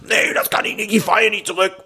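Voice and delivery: high, defiant mock woman's voice; stubborn woman. Driver imitates the stubborn woman refusing to back up.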